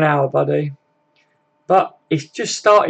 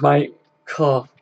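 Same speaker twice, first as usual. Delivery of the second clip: usual speaking voice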